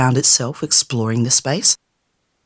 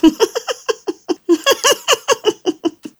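high, mocking fairy cackle. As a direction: cackle at the girl's shock. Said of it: "The fairy started to laugh".

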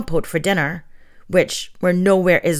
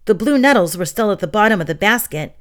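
usual speaking voice

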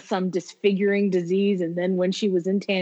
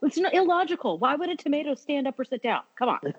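higher, exasperated rant; 9 semitones higher than usual. Retold or imitated. Fan quoting Michael's higher exasperated tomato rant. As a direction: argue it like an offended actor.

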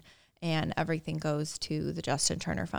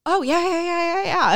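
excited; 13 semitones higher, 7 dB louder than usual. Retold or imitated. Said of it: "And we were like".

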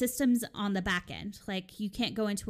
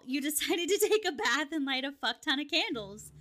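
higher-pitched, laughing protest; mock exasperation; 6 semitones higher than usual. Hotel clerk acting out a retort to a complaining guest, high mock exasperation. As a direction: retell an indignant retort, pitched up and half-laughing.

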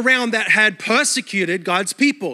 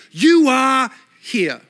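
you are here, drawn out slow and louder; slow map sign read; 3 dB louder, much slower than usual. Acted. Pastor reading the map sign to the congregation, slow and emphatic. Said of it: "looking for one thing".

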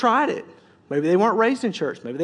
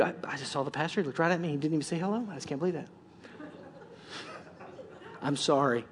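much softer, lower, sulky muttered complaint; mock hurt complaint; 5 semitones lower, 12 dB quieter, faster than usual. Preacher imitates a slighted member, much softer and sulky. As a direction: act the offended church member under your breath. Congregant hurt pastor didn't greet.

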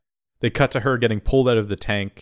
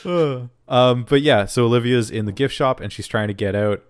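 usual speaking voice

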